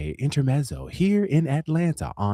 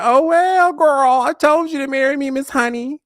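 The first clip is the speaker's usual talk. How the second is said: very high, loud singsong gloating; theatrical high-pitched "told you so"; sassy I-told-you-so; 6 dB louder than usual. Retold or imitated. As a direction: crow it in a high, mocking lilt. Acted gloating I-told-you-so retort.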